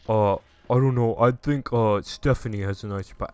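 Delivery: a hint of a laid-back bro; lightly voiced bro. Guy voiced in retold story.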